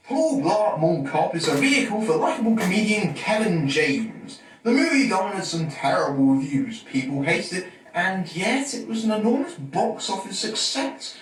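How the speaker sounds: projected, booming review opener; loud announcer intro. Acted. Reviewer introducing the film to viewers, loud and projected. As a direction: belt out the introduction at full volume.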